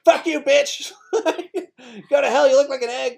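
put-on high, loud childish tantrum with laughter; screaming toddler. Three-year-old screaming insults at the neighbour's nanny, high and laughing. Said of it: "And I'd be like".

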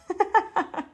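short high-pitched laugh; brief amused giggle, high; real laughter. Real, not acted. Amused at losing the thread.

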